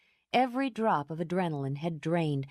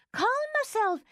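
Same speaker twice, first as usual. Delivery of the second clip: much higher, indignant repeat; outraged. Acted. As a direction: shoot up in outrage. Repeated words, second higher.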